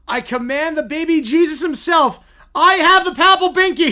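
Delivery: loud, high pompous proclamation; hammed-up pope; mock papal decree. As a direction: proclaim it with absurd pride. Bear voiced as rival pope.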